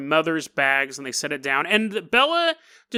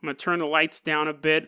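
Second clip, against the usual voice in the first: ordinary talk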